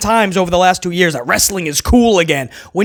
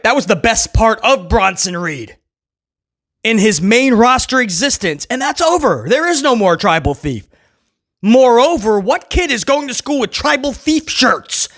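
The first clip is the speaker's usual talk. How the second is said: ordinary talk, nothing marked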